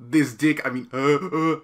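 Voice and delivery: loud flustered blurt. Acted.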